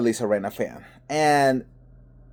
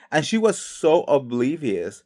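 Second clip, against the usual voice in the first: ordinary talk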